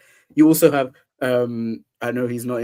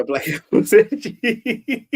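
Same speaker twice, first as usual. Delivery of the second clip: laughing; 8 semitones higher than usual. Real, not acted. Repeats the phrase, laughing.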